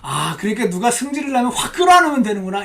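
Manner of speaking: loud, overdone sudden understanding; mock realization. Preacher parodying the wrong lesson, mock realization. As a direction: exclaim a false discovery.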